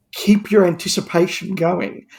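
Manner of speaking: ordinary talk, nothing marked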